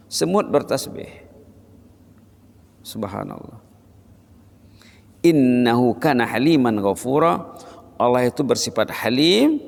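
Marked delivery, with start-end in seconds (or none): 2.9-3.6 hushed murmured subhanallah; whispered praise. Acted. Preacher murmuring praise, hushed. Soft dhikr aside.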